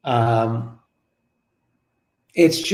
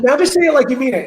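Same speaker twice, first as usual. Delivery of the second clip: high, quick retold urging; teasing coaxing; 10 semitones higher, 4 dB louder, faster than usual. Husband coaxing wife to repeat mantra, high and quick. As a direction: push playfully for one more try.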